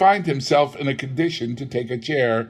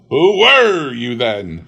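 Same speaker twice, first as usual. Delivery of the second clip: louder, slow pressing question; raised voice; 8 dB louder, slower than usual. Acted. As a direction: raise your voice at the ghost. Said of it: "raising his voice".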